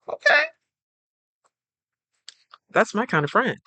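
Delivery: a friend pleasantly surprised, voice jumping up. Retold or imitated. A friend seeing the real you, squeaking an impressed "Okay". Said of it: "they're like".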